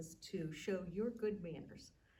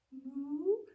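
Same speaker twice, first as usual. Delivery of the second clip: higher, sweet little moo; 7 semitones higher, 5 dB louder than usual. Acted. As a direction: moo as sweetly as you can. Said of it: "in her sweetest little voice".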